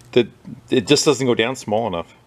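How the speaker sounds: normal talking voice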